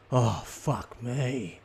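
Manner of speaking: very slow, drawn-out exasperated sigh of words; slow fed-up groan. Shown as a demonstration. Kid stuck waiting while parents chat, very slow groan. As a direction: drag it out like a bored kid. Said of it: "this is the equivalent of a kid saying".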